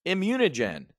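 high, eager hyped-up pitch; excited tip. Retold or imitated. Trader hyping a biotech stock to a friend: eager and high. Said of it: "He's like".

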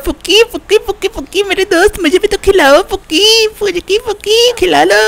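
high, whiny begging; pleading. Acted. Shinchan begs Pukki to let him play.

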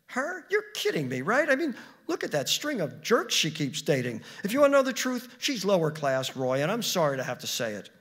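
high, loud, disbelieving tirade; scornful. Acted. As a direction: run her down with indignant disdain. Acted wife scorns the neighbour.

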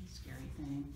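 ordinary delivery

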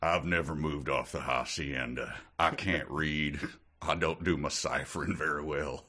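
lightly done Texas drawl. Pretend. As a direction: play the folksy Texas uncle. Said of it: "slow Texas drawl".

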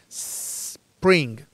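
"spring" with a very long S, split off before "pring"; snake-like S. Shown as a demonstration. Teacher exaggerating for learners, "spring" led by a long snake hiss. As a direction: draw out the hiss at the start of "spring". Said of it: "con el sonido ese de la serpiente".

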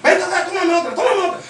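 mock insistent guy. Guy demands another photo.

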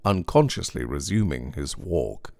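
ordinary delivery, nothing marked

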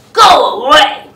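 loud grouchy snap; grouchy shout. Acted. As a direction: bark it at the nice bug. Grouchy ladybug snaps back.

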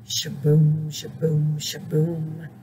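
low, slow rhythmic refrain, fading away; sweet old sound. A narrator's reading. Snake hears his band deep in the woods, low fading chant. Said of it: "the sweet old sound".

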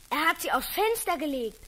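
normal talking voice

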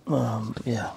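whispering. Real, not acted. Whispering before going outside.

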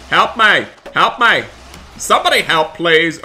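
high, loud repeated cries for help; mock pleading yell. Begging friends for help against the monster, loud. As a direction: beg anyone nearby to save you. Calling for help during attack.

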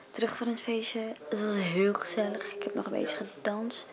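whispering. Real, not acted. Late night, whispering before sleep.

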